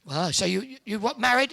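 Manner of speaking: ordinary talk, nothing marked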